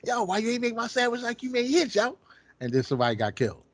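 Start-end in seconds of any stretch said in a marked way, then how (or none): 0.0-2.2 higher, imitating an angry yell over a sandwich; hammed-up furious demand; mock yell. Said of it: "how you be yelling all the fucking veins".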